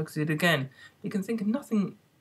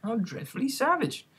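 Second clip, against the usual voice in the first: exclaiming; 8 semitones higher than usual. A narrator's reading. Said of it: "exclaimed Alice".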